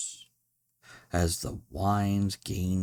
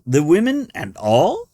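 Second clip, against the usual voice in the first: high, loud, startled question; astonished; 10 semitones higher, 11 dB louder than usual. A narrator's reading. Guest reacting to the women, astonished. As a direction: shoot up in disbelief.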